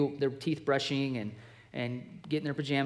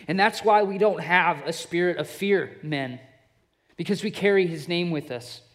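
louder and higher, driving each line home; preaching at full force; 6 semitones higher, 8 dB louder than usual. Acted. Preacher rallying the men against the devil's lies, full-force preaching. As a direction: preach it to the men, building with every phrase.

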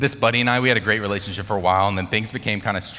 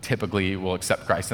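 ordinary talk

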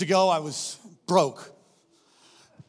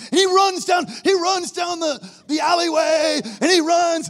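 high, loud shout as he acts out the run; excited storytelling shout; 11 semitones higher, 8 dB louder than usual. Acted. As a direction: shout the chase at full energy. Acting out the frantic run.

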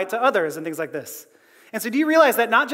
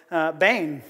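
usual speaking voice

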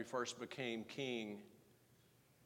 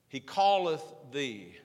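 slow and loud, calleth punched; emphatic refrain; 8 dB louder, slower than usual. Acted. Preacher pressing the verse on listeners, slow and loud. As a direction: hammer the refrain home.

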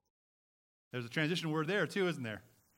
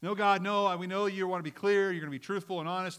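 loud, higher-pitched mock objection; acted protest to God; 5 semitones higher, 6 dB louder than usual. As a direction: push back at God, loud at first.

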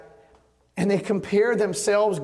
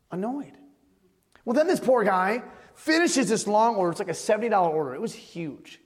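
ordinary talk, nothing marked

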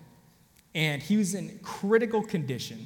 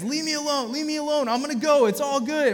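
high, loud mock yell of a confused man; yelling patient; 9 semitones higher, 5 dB louder than usual. A confused patient yelling high at the medics to leave him alone. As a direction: shout him off, high and insistent. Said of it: "he's yelling at us".

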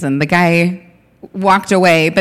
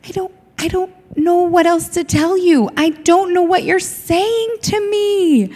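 helpless retold self; 10 semitones higher than usual. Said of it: "I'm like".

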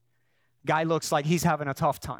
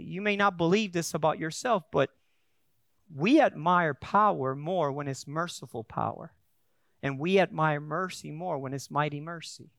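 ordinary talk, nothing marked

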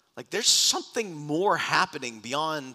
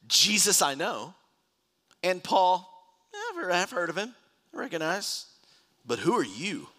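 lightly acted demon, pitched up; a hint of a challenging spirit, higher voice; evil spirit; 7 semitones higher, 4 dB louder than usual. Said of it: "the evil spirit answered them".

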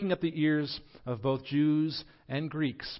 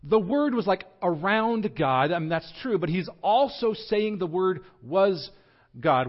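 slow, raised and emphatic; deliberately slow; 4 semitones higher, 4 dB louder than usual. Real, not acted. Preacher explaining an important distinction to listeners, slow and deliberate. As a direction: spell out the distinction slowly. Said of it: "I'm gonna say this slowly".

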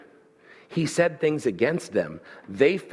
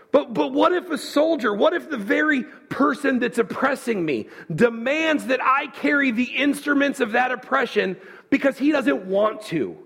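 high mock objection; indignant what-if, pitched high; whiny protest; 8 semitones higher than usual.

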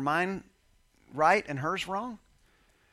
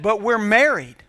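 loud, insistent wife's objection; indignant protest; 3 semitones higher, 9 dB louder than usual. Retold or imitated. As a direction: throw it back at him, louder. Wife protests during argument.

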